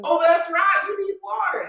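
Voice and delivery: sing-song taunt; high mocking voice. Mocking SpongeBob's predicament.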